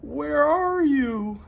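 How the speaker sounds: high, loud, drawn-out call; crying out. A narrator's reading. Hector adrift at sea calling for his lost friend. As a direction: call desperately into the storm. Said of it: "he cried".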